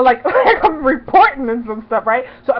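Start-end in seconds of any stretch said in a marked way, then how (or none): none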